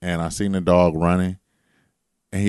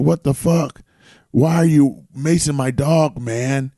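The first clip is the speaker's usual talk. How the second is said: mock outraged owner; 8 semitones higher, 5 dB louder, slower than usual.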